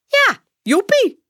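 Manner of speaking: hoog, luid en langzaam gejuicht; uitgelaten gejuich. A narrator's reading. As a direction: rek het juichend uit als een blij kind. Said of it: "roept Saar nog eens". (hoog en uitgelaten juichend)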